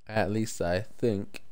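normal talking voice

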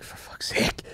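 whispered curse. Retold or imitated. Quoting themselves, tired in car.

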